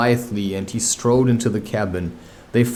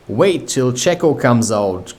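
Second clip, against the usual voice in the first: ordinary delivery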